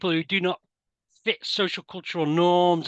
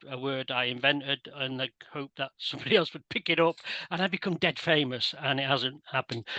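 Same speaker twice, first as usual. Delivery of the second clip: ordinary delivery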